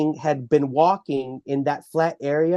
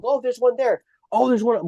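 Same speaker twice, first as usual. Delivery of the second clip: higher, excited pointing; freaking out; 7 semitones higher than usual. Retold or imitated. Family spotting wood structures in the woods, excitedly. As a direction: call out each find with a rush of amazement. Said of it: "We were just, like, freaking out".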